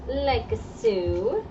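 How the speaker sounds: ordinary delivery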